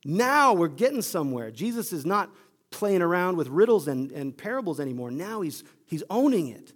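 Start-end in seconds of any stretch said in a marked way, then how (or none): 0.0-1.5 Peter thrilled. Acted. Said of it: "And Peter thinks".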